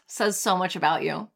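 usual speaking voice

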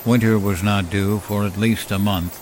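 ordinary delivery, nothing marked

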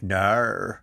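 drawn-out Australian "No."; Australian accent. Shown as a demonstration. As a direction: say "No." as an Australian, vowel bent toward "naur". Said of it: "I've mastered one Australian word".

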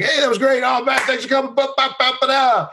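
fake friendly gush. Host gushing at rapper passing by, fake friendly. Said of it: "I'd be like".

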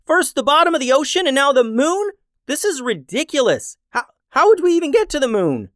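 exasperated yell. Acted. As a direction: throw up your hands, it's ridiculous. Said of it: "yelled Finn".

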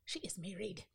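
whispering. Real, not acted. Gossip aside about friend.

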